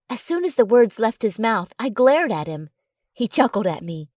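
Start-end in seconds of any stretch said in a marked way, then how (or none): none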